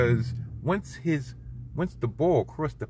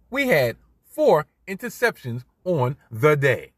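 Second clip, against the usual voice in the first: normal talking voice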